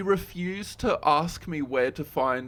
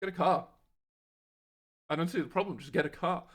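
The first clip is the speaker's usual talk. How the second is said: nonchalant; 4 semitones higher, faster than usual. Retold or imitated. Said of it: "my nonchalantness as I'm, like, just leaning against the wall".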